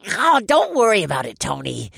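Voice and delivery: nasal voice. Acted. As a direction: whine it nasally. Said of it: "the nasally voice responded".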